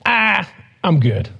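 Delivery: slow, drawn-out dismissive shrug; lazy brush-off. Retold or imitated. Believer brushes off God's request, slow lazy brush-off. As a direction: wave God off lazily. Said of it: "And we're like".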